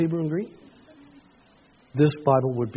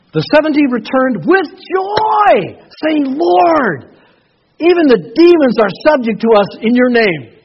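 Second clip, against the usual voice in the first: high, loud, slow scripture shout; booming pulpit reading of scripture; full-force preaching; 12 semitones higher, 10 dB louder, slower than usual. Acted. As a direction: proclaim it to the back row. Preaching the Luke passage aloud.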